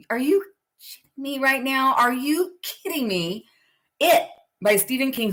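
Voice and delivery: high, incredulous mock outrage; disbelief. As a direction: throw up your hands, can't believe it. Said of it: "I was like".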